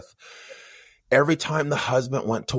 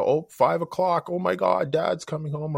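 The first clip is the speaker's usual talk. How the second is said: higher-pitched anxious imitation; mock alarm; 7 semitones higher than usual. Family realising Dad is due home, mock alarm. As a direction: act out mild panic, voice lifted.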